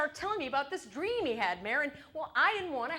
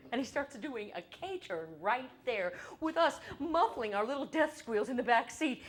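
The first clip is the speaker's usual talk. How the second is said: usual speaking voice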